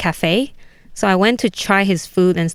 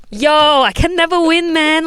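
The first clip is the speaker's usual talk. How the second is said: exasperated; 10 semitones higher, slower than usual. Retold or imitated. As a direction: complain you can never win. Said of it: "Then I'm like".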